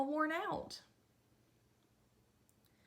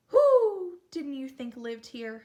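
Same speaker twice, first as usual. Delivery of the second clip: slow and much louder, booming; loud owl hoot; 11 dB louder, slower than usual. Acted. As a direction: boom it out like a startled owl. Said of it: "hooted the great horned owl loudly".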